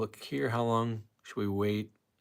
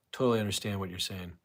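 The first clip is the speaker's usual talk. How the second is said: ordinary talk, nothing marked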